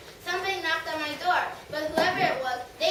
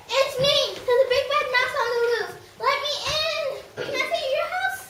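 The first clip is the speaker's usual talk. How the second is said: high, loud little mouse voice; squeaky mouse; 9 semitones higher, 5 dB louder than usual. Acted. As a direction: play the tiny mouse sweetly at the door. Mouse voice at door.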